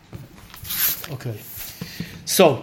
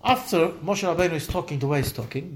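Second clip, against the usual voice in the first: normal talking voice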